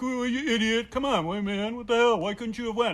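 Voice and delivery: friend's mock scolding. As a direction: chide him as a jolly bully.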